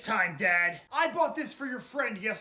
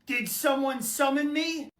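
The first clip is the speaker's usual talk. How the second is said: ordinary delivery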